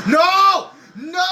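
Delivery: high, loud cry of no; loud shout. Real, not acted. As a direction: scream at the screen. Last-lap finish tension.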